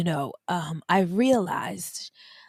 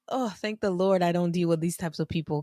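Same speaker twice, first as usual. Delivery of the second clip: normal talking voice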